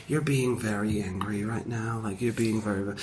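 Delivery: low, soft, restrained reproach; understated calm. Retold or imitated. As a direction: rebuke gently and low, barely raising the voice. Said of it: "they're being very understated".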